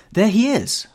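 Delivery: high, loud cry of recognition; excited cry. A narrator's reading. Girl spots her beloved passing, crying out. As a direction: cry out high as you spot him. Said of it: "she cried".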